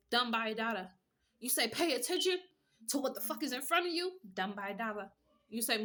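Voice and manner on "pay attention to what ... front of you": high, loud mock scolding; quoted demand. Reader mimics the viewer scolding, high and loud. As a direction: bark it out high like a command.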